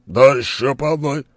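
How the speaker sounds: loud, high call for another; loud cheerful call. Acted. (громкий призыв)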